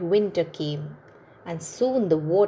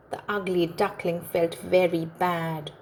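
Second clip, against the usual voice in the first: plain reading voice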